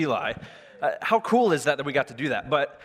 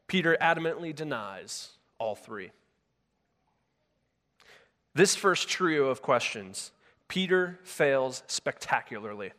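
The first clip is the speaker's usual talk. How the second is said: normal talking voice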